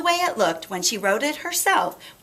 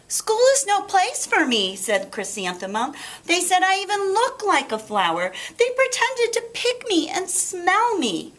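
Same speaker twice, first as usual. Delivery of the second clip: put-on whiny little girl; 10 semitones higher than usual. Chrysanthemum complaining to her parents, high and sulky. Child voiced complaining again.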